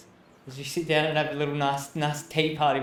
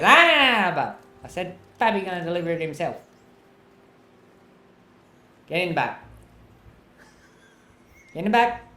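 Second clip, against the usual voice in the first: put-on high, pushy old man; old boss voice; 4 semitones higher, 6 dB louder than usual. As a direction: order them into the back. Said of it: "and Pappy goes".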